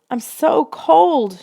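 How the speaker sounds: high, slow shouted complaint; shouting. A narrator's reading. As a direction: cry out the cold, stretched. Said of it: "she shouted".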